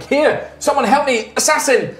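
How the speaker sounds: high, desperate call for help; fluttering cries for help. Acted. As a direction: cry out for rescue. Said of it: "she's like".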